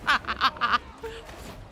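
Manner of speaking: real, open laughing; amused laugh. Real, not acted. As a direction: burst of laughter. Laughs at the crash bug.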